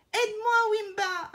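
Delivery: high, loud plea; imploring. A narrator's reading. Trapped elephant imploring the lion for help, desperate. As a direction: beg desperately from the pit. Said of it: "implore Éléphant".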